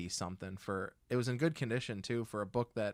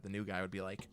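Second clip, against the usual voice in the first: ordinary talk, nothing marked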